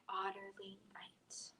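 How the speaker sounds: whispered, hushed close of the story. Acted. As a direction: breathe the last words softly. Hushed ending of bedtime story.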